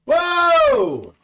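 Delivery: very high, loud "whoa"; amazed whoop. Real, not acted. Host reacting to co-host's rant, amazed whoop. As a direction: whoop it out in astonishment.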